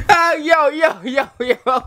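high giggling; catching breath after laughing. Real, not acted. Coming down from laughter, giggling.

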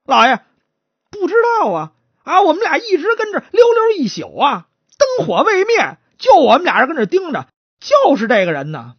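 high, tearful protest; pleading suspect. Acted. Chatterbox suspect telling the magistrate he knows nothing, pleading.

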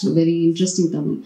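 normal talking voice

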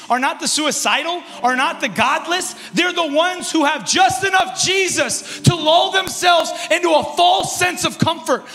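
loud, high, driving sermon climax; full-force preaching. Acted. Pastor rousing the church, loud full-force preaching. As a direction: preach it hard to the congregation.

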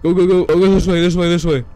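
loud, high urgent shouts; frantic yelling. Real, not acted. Gamer fleeing the goat man, yelling frantically. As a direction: yell at yourself to run.

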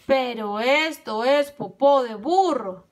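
slow, loud, emphatic outrage; wolf's shout. A narrator's reading. Tío Lobo tasting the first fritter, slow outraged shout. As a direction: shout it out, leaning on each word. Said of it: "gritó tío Lobo".

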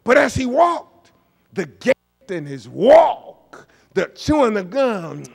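slow, loud, high dramatic buildup; preacher drawing out a punchline; preacher emphasis. Acted. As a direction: stretch it out for the crowd. Preaching at full force.

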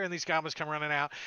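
normal talking voice

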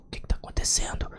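hushed question, pulled aside to a corner; whisper. Retold or imitated. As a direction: whisper it so nobody hears. Pulled aside, secret talk.

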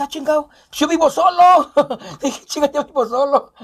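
chuckling at the punchline; amused dawning realization; giggly aside; laughing realization. Retold or imitated.

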